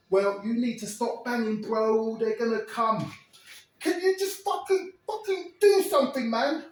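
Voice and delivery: high, loud, urgent yelling; loud pleading yell. Retold or imitated. Ez yells at his cellmate to stop, high and loud. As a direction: yell at him to snap out of it. Ez urging Terry.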